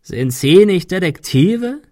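hoarse, drawn-out taunt; croaking. A narrator's reading. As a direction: rasp the question with scorn. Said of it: "krächzte er".

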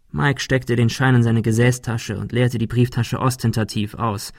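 usual speaking voice

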